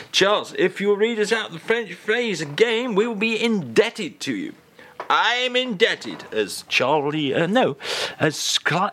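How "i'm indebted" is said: Cyril Fletcher impression. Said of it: "as Cyril Fletcher used to say".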